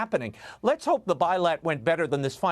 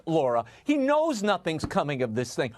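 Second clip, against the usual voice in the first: ordinary talk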